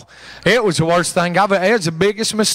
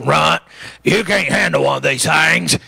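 a hint of an old smoker's rasp; imitating a wrecked smoker's voice; Bob's smoker voice. Preacher imitating old Bob turning down the boy. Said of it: "I should have known by the way he talked".

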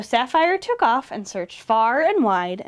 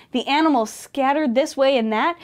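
plain reading voice

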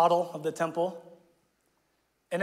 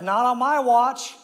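louder, higher, slow and resolute; firm declaration; 5 semitones higher, 7 dB louder, slower than usual. Retold or imitated. King purging the idols, louder resolute vow. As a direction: plant your feet and declare it. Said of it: "He's like".

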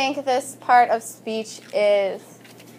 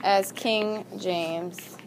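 word by word, drawn out with long pauses; slow dictation; 4 semitones lower, 4 dB quieter, much slower than usual. Shown as a demonstration. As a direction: dictate slowly for students writing it down. Dictates a sentence to the class.

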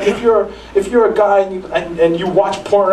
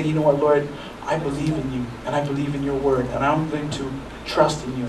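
soft, low, sincere words to God; quiet, sincere faith; trusting prayer; 4 semitones lower, 7 dB quieter than usual. Retold or imitated. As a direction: gently pledge trust to the Lord. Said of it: "Or you can just say".

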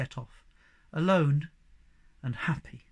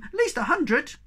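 high-pitched, eager Sniff-like voice; excited small creature; 11 semitones higher, 5 dB louder than usual. A narrator's reading. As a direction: pipe up with breathless news. Sniff excitedly adds his news.

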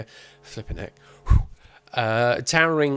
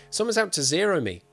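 a touch of a blunt femme fatale; 7 semitones higher, 4 dB louder than usual. Acted. Femme fatale telling the detective, blunt. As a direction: state it flat and demanding. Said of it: "attention demanding bluntness".

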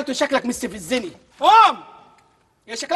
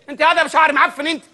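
ordinary talk

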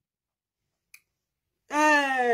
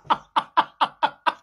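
laughing hard; 2 semitones higher than usual. Real, not acted. Gossip amused by the tale, laughing hard. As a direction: burst out in a run of laughs. Cracking up at own story.

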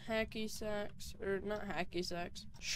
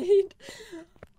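high, loud nervous burst of laughter; 12 semitones higher, 10 dB louder than usual. Real, not acted. Terrified ghost hunter releasing tension: high loud nervous laugh. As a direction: laugh off the fear shrilly.

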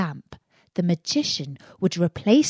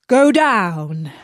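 firm, louder order; command; 6 dB louder than usual. Acted. Magician orders Aladdin into the cave, firm louder command. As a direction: bark it down the stairs. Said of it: "commanded the magician".

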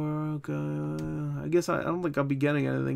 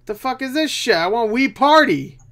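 frustrated shout; 9 semitones higher, 7 dB louder than usual. Real, not acted. Streamer yelling at a useless eBay search, fed up.

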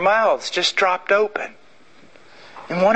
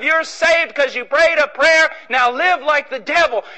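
mocking; 8 semitones higher, 3 dB louder than usual. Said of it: "this damnable doctrine".

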